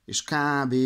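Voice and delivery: ordinary talk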